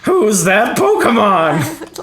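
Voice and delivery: high, slow, loud TV announcer; announcer impression. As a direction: belt it like the cartoon announcer. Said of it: "I like the voice crack".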